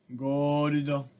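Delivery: gordo slowly, go-ru-do rolled together; Japanese loanword. Shown as a demonstration. Teacher demonstrating gold in Japanese: ゴールド. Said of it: "gold se vuelve en gordo".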